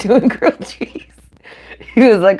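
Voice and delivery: requoting it through a laugh. Retold or imitated. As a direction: chuckle while repeating the quote. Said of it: "just because he said".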